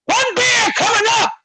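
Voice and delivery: high, loud mocking voice; high mocking bartender. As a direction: echo him in a high voice.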